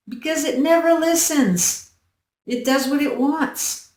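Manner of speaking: high-pitched exasperated excuse; mock complaint. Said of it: "every day he complained".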